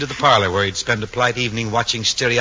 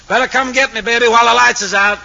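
higher, louder cocky call; brash young fellow; 9 semitones higher, 5 dB louder than usual. Acted. Young man calling to his girl from the couch, brash and loud. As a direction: holler it like a brash hot-rod teenager. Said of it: "plops himself right in front ... and says".

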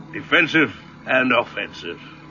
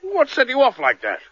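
startled demand; 13 semitones higher than usual. Acted. Man puzzled by her fright.

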